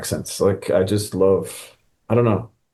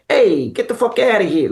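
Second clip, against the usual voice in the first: high, hammed-up New York archetype; broad New York caricature, brash and playful; cartoony New York accent; 10 semitones higher than usual. Shown as a demonstration. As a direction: go full cartoon New Yorker, pitched up. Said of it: "start with the archetype".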